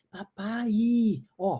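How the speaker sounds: ordinary delivery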